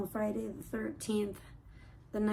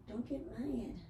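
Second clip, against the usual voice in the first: ordinary delivery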